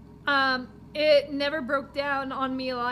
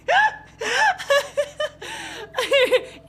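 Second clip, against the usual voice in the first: big high-pitched laugh; high laughter; 11 semitones higher, 6 dB louder than usual. Real, not acted. Reacting to the driver, loud high laugh. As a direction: burst out laughing.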